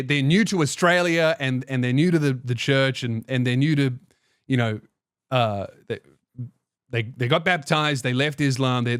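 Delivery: ordinary talk, nothing marked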